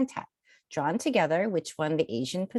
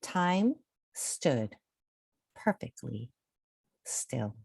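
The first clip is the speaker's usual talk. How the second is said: much softer and lower, drawn out; hushed slowing; 6 semitones lower, 6 dB quieter, much slower than usual. A narrator's reading. Storyteller to kids, hushing as time stops. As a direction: let the voice freeze with time.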